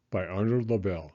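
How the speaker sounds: ordinary delivery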